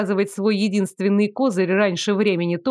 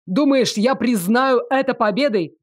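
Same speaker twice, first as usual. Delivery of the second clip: mildly raised, disbelieving shout; narrated angry retort; indignant yell; 3 semitones higher than usual. A narrator's reading. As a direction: throw it back in outrage, without full volume. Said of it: "заорал в ответ оборотень".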